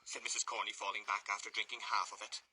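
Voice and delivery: plain reading voice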